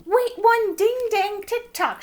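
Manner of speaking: high, loud, drawn-out shout. A narrator's reading. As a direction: call out a halt, high and slow. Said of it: "shouted the nurse".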